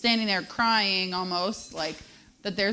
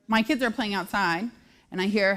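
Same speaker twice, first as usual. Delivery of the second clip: ordinary talk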